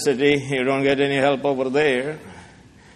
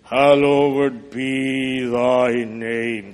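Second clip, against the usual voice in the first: solemn prayer; 3 semitones lower, much slower than usual. Acted. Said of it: "Our Father in heaven".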